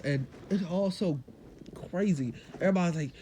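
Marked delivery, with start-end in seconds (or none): none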